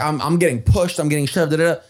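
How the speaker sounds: ordinary talk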